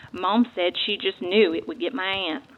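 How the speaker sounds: usual speaking voice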